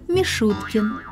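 usual speaking voice

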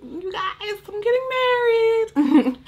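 high, teary squeal of excitement; squealing with joy. Pretend. A bride-to-be on the beach squealing the news. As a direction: shriek it like happy near-tears. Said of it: "and be like".

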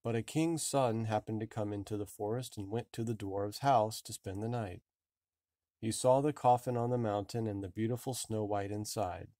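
plain reading voice

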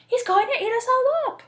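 high, loud cry of warning; alarmed cry. A narrator's reading. Dot warns about the wolf, alarmed.

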